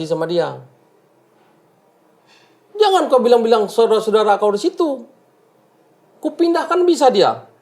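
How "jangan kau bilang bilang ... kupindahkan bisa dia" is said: raised, high-pitched imitation of a scolding; imitated bullying outburst; mock snapped rebuke. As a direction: re-enact an arrogant telling-off, pitched well up. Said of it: "Kok saya dibentak-bentak ya".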